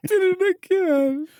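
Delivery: very high, squealing fake sob; hammed-up tearful wail through laughter; mock crying. Joking over a friend's story, high mock crying. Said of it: "I'm crying".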